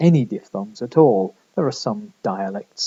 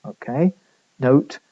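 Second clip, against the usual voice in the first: normal talking voice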